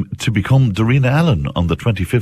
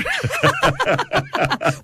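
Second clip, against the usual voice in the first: high laugh; 4 dB quieter than usual. Real, not acted. Laughing at mother joke.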